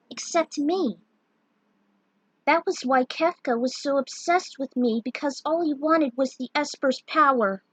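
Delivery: lightly voiced Terra recalling her past; Terra's voice.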